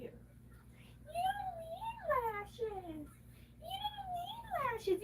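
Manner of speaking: high, slow fake-crying whine; hammed-up weepy wail; mock sobbing wail. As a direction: wail it like a pretend cry.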